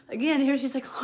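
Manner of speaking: high, loud tearful voice; real crying. Real, not acted. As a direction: keep talking while crying. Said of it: "I shouldn't be crying right now".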